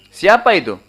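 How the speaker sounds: acted alarmed demand; calling out a challenge. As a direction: call out to an unknown voice. Acted startled challenge to unknown voice.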